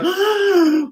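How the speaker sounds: high imitation of an audience gasp; exaggerated collective-shock gasp; mock shocked gasp. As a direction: gasp like a scandalised crowd. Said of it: "just to hear everyone go".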